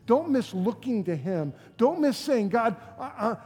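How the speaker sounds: loud, driving sermon delivery; forceful preaching. Real, not acted. Preacher telling the church this is no checklist, forceful. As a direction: press the point home to the congregation.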